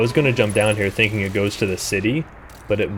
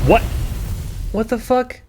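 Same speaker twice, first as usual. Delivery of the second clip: high, startled yelp of disbelief; shocked outburst; 12 semitones higher than usual. Real, not acted. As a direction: caught totally off guard. Sudden death in game.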